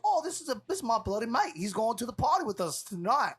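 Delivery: high, cheery British voice; British bloke impression. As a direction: vouch for your mate like a Londoner. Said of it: "And he goes".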